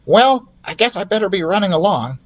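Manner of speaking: high little mouse; high squeaky mouse. Acted. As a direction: mouse taking leave. Ralph voiced.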